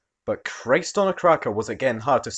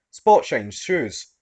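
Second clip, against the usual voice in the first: usual speaking voice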